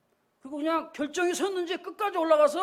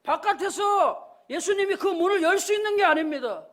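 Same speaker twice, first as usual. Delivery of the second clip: raised, loud pulpit exhortation; forceful preaching; 4 semitones higher, 5 dB louder than usual. Real, not acted. Pastor urges listeners to open their minds, forceful preaching. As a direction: drive the message home to the congregation.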